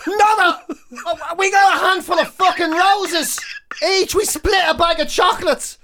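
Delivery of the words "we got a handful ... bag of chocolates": usual speaking voice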